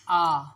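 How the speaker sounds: "आ" as a loud, open "aah"; open A vowel. Shown as a demonstration. Teacher presenting the first vowel, "आ" loud and open. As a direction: sound out "आ" loudly as a long open A. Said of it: "English का ए है वैसे".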